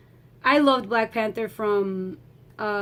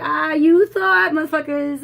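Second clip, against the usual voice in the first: Thanos; 6 semitones higher, 8 dB louder than usual. Acted. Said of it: "how Thanos came in".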